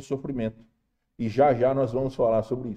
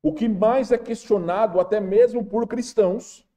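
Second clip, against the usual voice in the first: raised, fervent preaching; 6 semitones higher, 3 dB louder than usual. Acted. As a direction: preach it with rising fervor. Preaching at full force.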